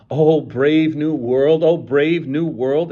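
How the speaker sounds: slow, loud ringing exclamation; rapturous. A narrator's reading. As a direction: proclaim it in wonder. Savage's singing words, rapturous.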